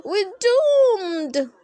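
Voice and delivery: high, loud despair; terrified wail. Acted. Boy to friends, as the ground crumbles. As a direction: wail that all is lost. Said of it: "cried Harold".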